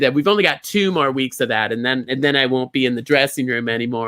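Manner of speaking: ordinary talk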